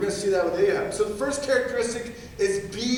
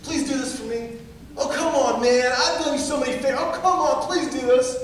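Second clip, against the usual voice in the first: whiny high pleading, pitched up; hammed-up pleading plea; mock begging; 5 semitones higher than usual. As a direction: beg and wheedle like a manipulator. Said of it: "they'll use begging, pleading".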